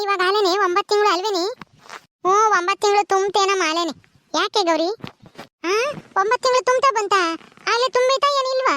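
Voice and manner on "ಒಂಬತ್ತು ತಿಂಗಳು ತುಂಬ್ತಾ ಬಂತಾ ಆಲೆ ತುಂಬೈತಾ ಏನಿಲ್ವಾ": high-pitched, incredulous asking; astonished question. Acted. Woman learning the baby is already nine months, astonished. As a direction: ask it in disbelief, pitched up.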